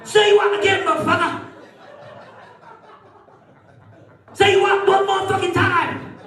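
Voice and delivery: high, shouted mock gangster threat; Pulp Fiction gangster. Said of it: "that bloke in Pulp Fiction".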